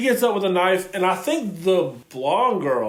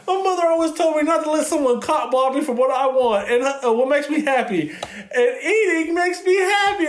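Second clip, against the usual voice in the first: higher, hammed-up Tiffany impression, slowing on the last line; mock Tiffany voice; 8 semitones higher than usual. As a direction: play Tiffany half-jokingly, pitched up. Retelling Tiffany's line in a scene.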